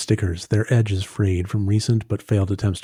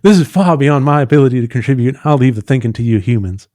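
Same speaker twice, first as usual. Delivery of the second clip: put-on louder, higher character accent; Gretchen's accent; 3 semitones higher, 6 dB louder than usual. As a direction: put on the accent with emphatic projection. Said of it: "so that I can do the accent".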